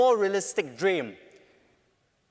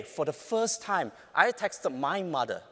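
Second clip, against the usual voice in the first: ordinary talk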